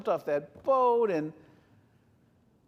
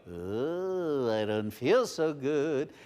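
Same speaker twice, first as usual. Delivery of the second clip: seasick groan; 6 semitones lower, slower than usual. Acted. Seasick passengers quoted.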